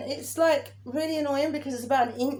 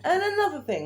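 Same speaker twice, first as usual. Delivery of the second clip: mock ranting catchphrase, pitched up; imitating a rant; 7 semitones higher than usual. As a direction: parody your friend's rant opener. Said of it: "This is you when Michelle was in".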